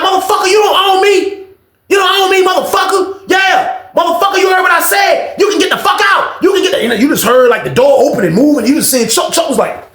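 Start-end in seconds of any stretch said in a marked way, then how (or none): 0.0-6.8 furious shouting. Retold or imitated. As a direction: throw him out, full volume. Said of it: "Bro, I was like".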